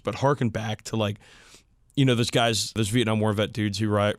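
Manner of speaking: usual speaking voice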